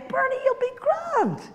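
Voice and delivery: high, breezy mock reassurance; lightly mocking cheerful voice; mimicking friends. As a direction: chirp it like a dismissive friend. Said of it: "people that said to me".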